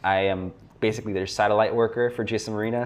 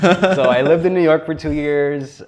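laughing while talking; 7 semitones higher, 9 dB louder than usual. Real, not acted. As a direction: talk through a real laugh, louder and higher. Laughing after his own story.

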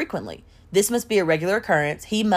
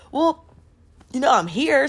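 higher, sheepishly casual voice; imitated casual man; 7 semitones higher than usual. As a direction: lightly voice him making his case. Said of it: "He's like".

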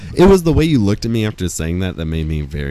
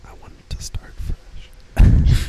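whispering; much slower than usual. Pretend. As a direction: hush it like a secret. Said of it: "Why are you whispering?".

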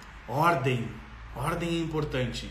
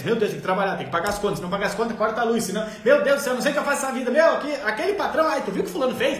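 higher, louder frantic complaining about bills and boss; stressed nagging parent; 5 semitones higher, 4 dB louder, faster than usual. Retold or imitated. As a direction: whine like an overwhelmed parent venting.

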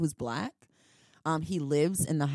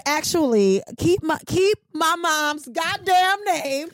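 mock angry warning; 8 semitones higher, 5 dB louder than usual. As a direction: lay down the law, fired up. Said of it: "She said".

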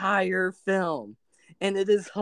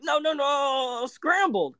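high fussy correction; 8 semitones higher, slower than usual. Retold or imitated. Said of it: "you'll be like".

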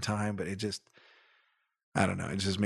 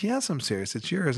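imitating a kind lady; 6 semitones higher than usual. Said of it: "she's like".

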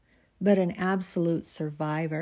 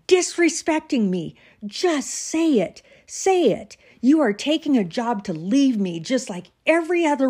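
much higher and louder, rising to a yell; furious shout; 5 semitones higher, 5 dB louder than usual. Acted. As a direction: explode at her, daring her to leave. Husband's outburst at wife, then exit.

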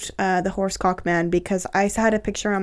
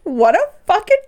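outraged outburst; 11 semitones higher than usual. Real, not acted. Friend judging the blocked guy, outraged. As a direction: let it out in a high, indignant voice.

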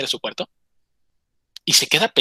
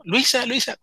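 retold shout, pitch raised only; mock calling out; 9 semitones higher, slower than usual. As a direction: call her name through a door, held back. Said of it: "Y le empieza a gritar".

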